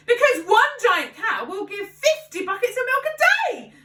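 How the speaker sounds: high, loud excited cry; an inventor dreaming out loud; cried excitedly. A narrator's reading. Father cries out his plans to George, high and loud. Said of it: "cried Mister Cranky".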